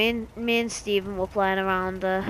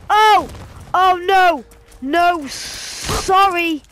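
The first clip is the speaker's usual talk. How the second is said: startled panic; 7 semitones higher, 6 dB louder, much slower than usual. Real, not acted. Ambushed by monster in game.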